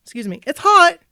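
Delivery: high-pitched mock annoyance; pissed off. Woman imagines the star pissed off about his foot, mock annoyed. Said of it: "could have been pissed off".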